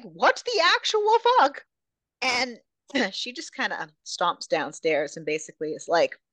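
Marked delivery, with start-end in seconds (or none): 0.0-1.6 outraged exclamation. Real, not acted. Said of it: "I mean, rightly so".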